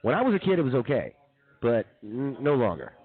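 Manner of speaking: ordinary delivery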